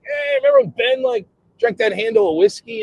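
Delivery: high-pitched acted reminiscing; excited storytelling. Founders swapping drinking stories in heaven, acted high excitement. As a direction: launch gleefully into an old tale.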